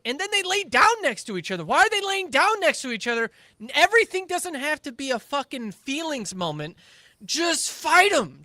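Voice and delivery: loud, fast, exasperated rant. Real, not acted. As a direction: vent it loudly, picking up speed. Ranting about the show.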